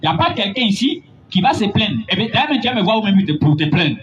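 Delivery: ordinary talk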